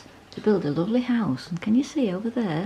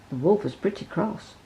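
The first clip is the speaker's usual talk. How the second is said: ordinary delivery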